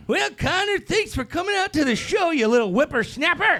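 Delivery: put-on high, cranky old-timer scolding; old man voice. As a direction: play a crotchety grandpa. Old-timer voicing of whippersnapper.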